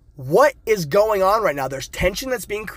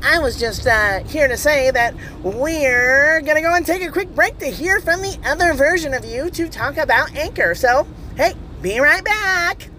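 goofy pitched-up voice announcing a quick break; funny put-on voice; 8 semitones higher than usual. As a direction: play the oblivious alter ego handing off to a break. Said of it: "do you keep talking like that?".

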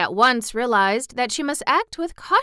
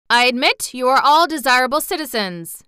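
mildly stiff concession, louder and higher; rather stiff; 5 semitones higher, 7 dB louder than usual. A narrator's reading. Mister Bunn conceding to rival families, rather stiff. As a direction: concede it with wounded formality. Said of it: "rather stiffly".

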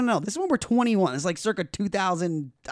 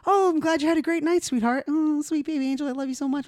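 high, sugary mother's voice; sweet mom voice; 10 semitones higher than usual. Retold or imitated. Scene: mother greeting her son at breakfast, high sugary coo. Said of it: "Rosalie goes".

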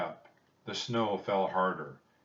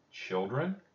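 plain reading voice

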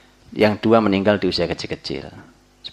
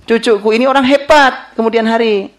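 higher, louder proud boast about a grandchild; proud declaration; 11 semitones higher, 6 dB louder than usual. Retold or imitated. Preacher voicing a proud grandparent, loud and high. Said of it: "berkata positif seperti kalimatnya Abdul Muttalib".

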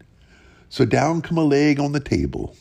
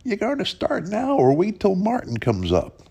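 whiny voice; 9 semitones higher than usual. Acted. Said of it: "He says in a low, whiny voice".

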